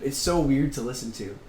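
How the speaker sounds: normal talking voice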